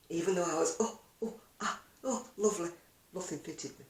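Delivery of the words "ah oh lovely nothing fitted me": usual speaking voice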